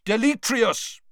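loud, high shouted incantation; shouted spell. A narrator's reading. Wizard banishing the skull, shouting. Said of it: "Mister Diggory shouted".

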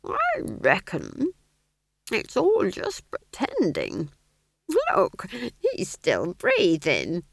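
drawn-out, high, swooping deduction; slow pondering. A narrator's reading. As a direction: reason it out very slowly. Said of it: "she said slowly".

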